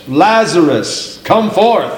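slow, ringing summons, pitch climbing; loud cry. Acted. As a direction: call him out of the tomb. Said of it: "he cried with a loud voice".

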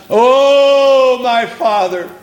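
high, pleading cry to God, voice breaking; high anguished wail, rising to a shout; anguished crying prayer. Retold or imitated. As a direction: cry out like a man in agony. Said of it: "Did you ever picture Jesus crying unto God?".

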